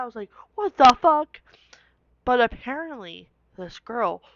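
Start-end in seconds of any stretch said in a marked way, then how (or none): 0.6-1.4 high, loud what the fuck; shocked outcry. Retold or imitated. To her friend across the hall, a loud shocked outcry.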